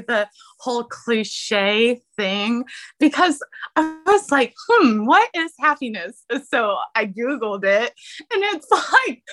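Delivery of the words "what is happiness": ordinary talk, nothing marked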